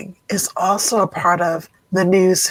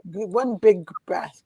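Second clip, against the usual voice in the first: slow soft guiding; 7 dB quieter, slower than usual. Shown as a demonstration. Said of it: "guiding breath slowly".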